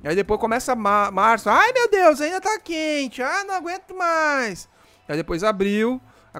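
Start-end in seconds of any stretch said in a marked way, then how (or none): 1.5-4.7 high, slow exaggerated complaining; whiny mock voice. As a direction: moan about the heat in a high voice. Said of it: "Não aguento aqueles caras que já começam".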